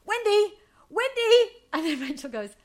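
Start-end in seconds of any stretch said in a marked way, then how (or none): 0.0-1.5 high, loud imitation of someone calling a name; mock insistent calling. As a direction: call the name higher and louder each time. Acts Monica calling on the phone.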